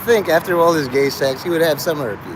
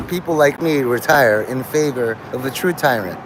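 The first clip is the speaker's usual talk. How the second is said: ordinary talk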